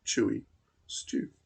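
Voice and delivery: plain reading voice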